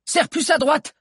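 very high, loud command; high-pitched urgent instruction; urgent order. A narrator's reading. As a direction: shout a steering order to the driver. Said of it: "ordonna-t-il".

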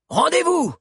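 high-pitched commanding yell; soldier's ringing order to the enemy; loud shout. A narrator's reading. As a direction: call it out across the water. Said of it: "cria-t-il d'une voix forte".

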